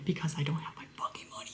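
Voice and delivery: soft whisper under the polite reply; whispered aside. Real, not acted. Aside to viewers, a soft whispered complaint. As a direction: hiss the real thought under your breath.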